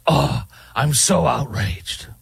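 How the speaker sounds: slow, mocking outraged voice; sarcastic fake outrage. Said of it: "talk like I'm holier than thou".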